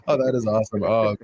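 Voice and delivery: ordinary delivery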